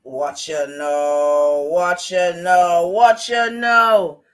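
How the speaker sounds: slow, loud chant rising with each repeat; drawn-out taunt. Real, not acted. Drawn-out challenge to the chat.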